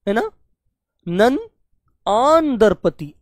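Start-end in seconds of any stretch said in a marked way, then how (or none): 2.1-3.1 आन drawn out slowly with a long open aa; slow syllable by syllable आन दरपति; slow aa sound. Shown as a demonstration. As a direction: stretch आन so the aa sound is clearly heard. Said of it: "आ की आवाज भी आई है इसलिए".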